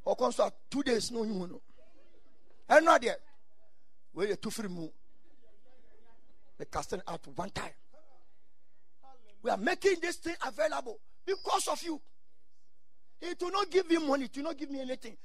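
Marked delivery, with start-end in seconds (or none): none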